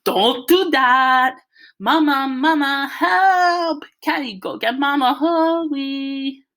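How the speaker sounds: high, loud, drawn-out wail; frantic stuck-lipped yelling. A narrator's reading. Byron to Kenny as Dad tugs him, frantic wail. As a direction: howl for Mama through frozen lips. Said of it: "Bai went nuts again".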